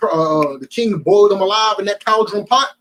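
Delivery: normal talking voice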